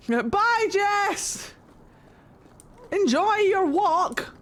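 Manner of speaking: high sing-out farewell, words stretched; calling goodbye. Pretend. Streamer to Jess going off on a walk, playful high farewell call. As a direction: call after someone leaving in a high voice.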